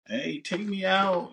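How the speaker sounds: high, drawn-out plea to be taken out; acted date request. A date asks to be taken out, high mock pleading.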